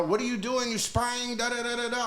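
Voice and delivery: high mock yell of a security guard; mock angry guard yelling, high; retold yelling. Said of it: "he starts yelling at me".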